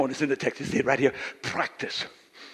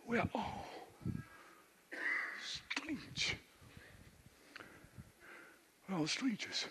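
very soft, higher; hushed reflection; 6 semitones higher, 17 dB quieter, slower than usual. Acted. Pastor quietly telling the church everyone is strange, very soft. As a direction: let it fall to almost nothing.

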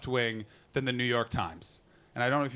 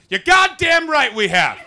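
slow, much higher and louder boast; mock proud shout; 11 semitones higher, 14 dB louder, slower than usual. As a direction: bellow it like a proud fan. Fan answering on audience's behalf.

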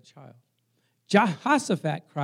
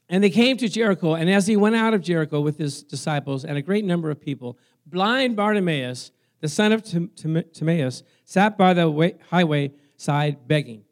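loud, projected Bible reading; scripture reading; 8 dB louder than usual. Real, not acted. Preacher reads Bartimaeus story to congregation, loud proclaiming reading. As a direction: read it out strongly to the congregation.

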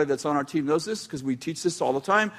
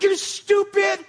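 high, slow, stretched-out mock insult at another driver; hammed-up shriek at a bad driver; mock road rage; 13 semitones higher, 3 dB louder, slower than usual. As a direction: squeal it out at the driver who cut you off. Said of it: "and you're like".

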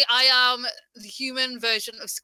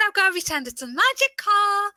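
high, excitable toy-shop pitch; really happy, excitable; 8 semitones higher than usual. Shown as a demonstration. Pretending for children at the toy shop, high and happy. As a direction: sell the magic, pitched up. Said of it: "I've gotta sound really happy".

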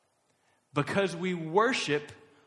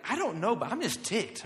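higher-pitched mock irritation; mock ticked off; 5 semitones higher than usual. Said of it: "you, like, snap at somebody".

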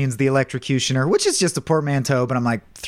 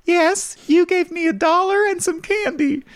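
put-on much higher, childlike voice; child witness; 12 semitones higher than usual. Child witness answering the lawyer, high kid voice. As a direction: talk like a little kid on the stand. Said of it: "Isn't it true".

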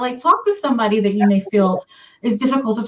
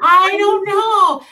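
embarrassed laugh; 10 semitones higher, 5 dB louder, much slower than usual. Real, not acted. Embarrassed reply to teasing.

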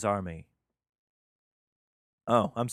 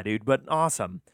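normal talking voice

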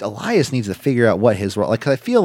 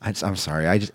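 ordinary talk, nothing marked

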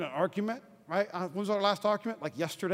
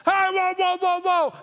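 high, raised cry of "whoa"; calling a halt; 9 semitones higher, 4 dB louder than usual. Acted. Preacher as Caleb before Moses, high halting shout. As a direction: silence a crowd with quick calls.

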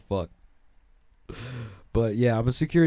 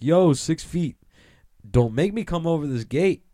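a hint of a tough street accent, barking a warning; a light tough-guard accent: back off from the gate; 7 semitones higher, 4 dB louder than usual. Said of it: "Wow, I should do accents".

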